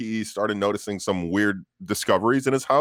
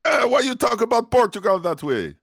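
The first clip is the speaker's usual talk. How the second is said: much higher mock Jamaican accent; a hammed-up Jamaican accent complaining; 14 semitones higher, 4 dB louder than usual. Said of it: "Sounded Jamaican".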